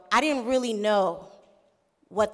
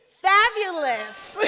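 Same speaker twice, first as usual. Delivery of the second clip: slow, higher-pitched exclamation; drawn-out relish; 8 semitones higher, 3 dB louder, much slower than usual. Real, not acted. Speaker basking in the crowd's applause, drawn-out relish. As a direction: stretch it out with relish. Reacting to loud welcome applause.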